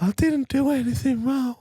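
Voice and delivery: creepy-friendly in his light voice; killer impression. Still doing the killer voice.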